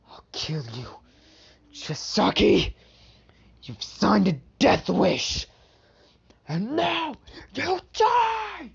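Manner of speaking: slow menace rising to a high cry; cold then furious threat. Acted. Izuku vows to kill Chisaki.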